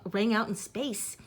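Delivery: plain reading voice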